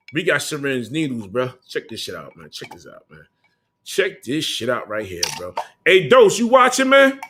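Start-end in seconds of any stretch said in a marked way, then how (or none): 1.7-2.5 hushed. Real, not acted. Showing item on stream, hushed. 2.5-3.1 much softer, under the breath; hushed aside to the camera. Real, not acted. Showing item, hushed. 3.1-3.3 hushed. Real, not acted. Showing item on stream, hushed. 5.8-7.2 calling out. Real, not acted. Calling out to rival on stream.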